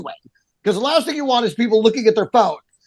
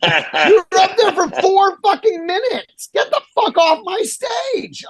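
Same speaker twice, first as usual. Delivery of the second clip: high, slow, shouted outrage; retold furious yell; 10 semitones higher, slower than usual. Host yelling at a guest comic hogging the stage, high slow furious yell. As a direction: rage at him to get off the stage. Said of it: "And I'm like".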